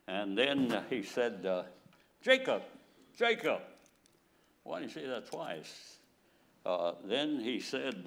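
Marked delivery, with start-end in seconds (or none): 2.2-3.6 loud call, first one higher; preacher voicing God's loud summons. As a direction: summon him by name, sharply falling. God calling Jacob by name twice.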